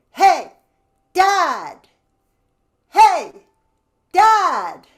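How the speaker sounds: shouting across the house; calling out. Shown as a demonstration. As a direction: call out loud from the belly. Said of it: "Feel your stomach muscle go in".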